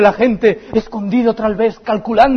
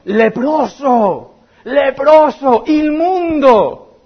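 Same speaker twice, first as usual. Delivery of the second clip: drawn-out cry, rising higher; mock calling out; 7 semitones higher, 4 dB louder, much slower than usual. Leper warning the crowd from afar, slow drawn-out cry. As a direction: call it slowly from far away. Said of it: "tenía que ir gritando".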